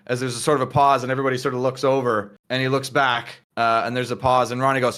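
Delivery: usual speaking voice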